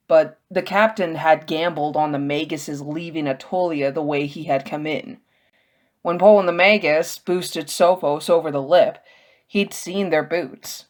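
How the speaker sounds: ordinary delivery, nothing marked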